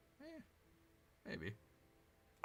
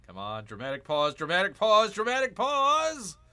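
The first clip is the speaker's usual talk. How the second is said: starts near usual pitch, climbs to a high yell; louder and higher on each repeat, last one stretched; building mock-dramatic shout; 8 semitones higher, 11 dB louder than usual. Real, not acted. Mocking the game's pause, building up.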